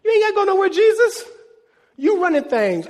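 hammed-up eager centurion; centurion insisting. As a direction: cry out urgently to Jesus. Centurion line repeated louder.

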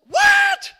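very high, loud shocked shriek; mother's outraged "What?". Retold or imitated. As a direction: shriek it in disbelief.

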